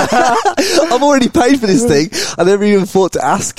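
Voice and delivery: high, loud laugh through the words; real laughter breaking through. Real, not acted. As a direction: crack up while saying it. Laughs at his own mistake.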